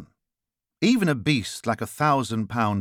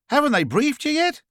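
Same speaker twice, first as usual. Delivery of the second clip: high-pitched Australian caller; incredulous question in an Australian accent; 4 dB louder than usual. A narrator's reading. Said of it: "noticing the Australian accent".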